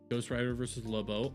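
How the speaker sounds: usual speaking voice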